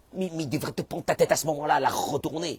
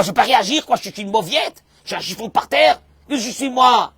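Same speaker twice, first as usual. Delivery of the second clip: angry protest; 8 semitones higher, 11 dB louder than usual. Acted. Acted angry spouse protesting.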